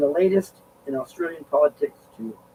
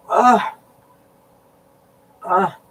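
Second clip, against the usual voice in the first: pained groans; 6 semitones higher, 7 dB louder than usual. Real, not acted. Back pain, groaning.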